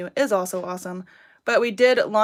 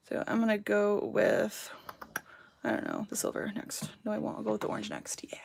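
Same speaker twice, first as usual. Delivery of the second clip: soft unvoiced whisper while choosing colours; murmured private aside, barely voiced; whispering to herself; 8 dB quieter than usual. Real, not acted. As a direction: whisper it under your breath, thinking aloud. Talking to herself while choosing colors.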